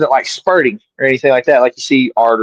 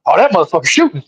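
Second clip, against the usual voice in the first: strained mock-panicked exclamation; retold alarmed cry; 3 semitones higher, faster than usual. Man imitating himself on video under fire, mock alarm. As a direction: replay a startled cry, about to laugh.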